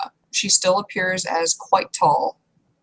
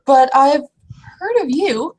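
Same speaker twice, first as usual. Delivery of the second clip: high, louder eager voice; eager troll woman; 8 semitones higher, 3 dB louder than usual. Acted. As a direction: tell him brightly you know him.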